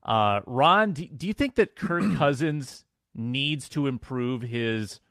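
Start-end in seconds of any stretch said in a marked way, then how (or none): none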